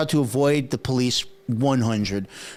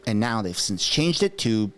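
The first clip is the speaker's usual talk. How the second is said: ordinary talk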